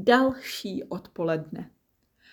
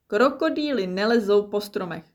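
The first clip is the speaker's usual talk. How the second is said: brisk recital; 3 semitones higher, 4 dB louder, faster than usual. Acted. Child repeating the rule.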